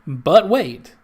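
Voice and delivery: loud, high TV pitchman hype; infomercial voice. Pretend. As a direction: sell it like a late-night ad. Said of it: "my best, you know, infomercial voice".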